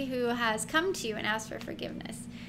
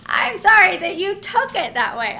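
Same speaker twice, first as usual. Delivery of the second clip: higher, louder, exaggerated mock sorry; hammed-up non-apology; 7 semitones higher, 10 dB louder than usual. Worked up about non-apologies, mocking them higher and louder. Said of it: "let me go off on that".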